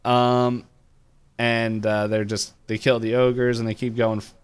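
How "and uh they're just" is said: ordinary talk, nothing marked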